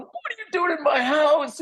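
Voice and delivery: very high, retold squeal of recognition; mock excited scream. Son and friend on phone, imitated screaming. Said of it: "they're, like, screaming at each other".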